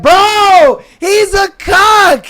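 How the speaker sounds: high, loud, stretched-out exclamation; shouted verdict. Real, not acted. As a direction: blurt the verdict out, really loud. Outburst on hearing the question.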